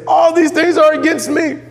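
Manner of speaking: very high, drawn-out mock lament; hammed-up, high-pitched complaint; mock despairing wail. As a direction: wail it slowly in exaggerated despair. Mimics despairing complaint, against calm answer.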